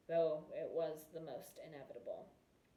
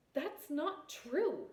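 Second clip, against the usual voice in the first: sharp, slow, higher retort; snapped; 9 semitones higher, 5 dB louder, much slower than usual. A narrator's reading. Princess snapping back at the prince during the dance. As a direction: bite the words off in anger. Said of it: "she snapped".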